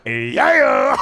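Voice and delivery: loud, drawn-out celebrating yeah; excited cheer. Retold or imitated. Driver seeing the cop, thinks discount, excited cheer. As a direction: cheer as if luck just struck.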